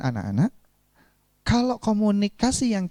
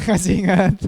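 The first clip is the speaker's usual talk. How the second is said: laughing as the question comes out; 4 dB louder than usual. Real, not acted. Trainer asks whether class remembers, laughing. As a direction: ask through a chuckle.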